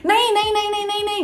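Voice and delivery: high, rapid panicked refusals; frantic no. Retold or imitated. Refusing to stand with a stained skirt, high panicked no's.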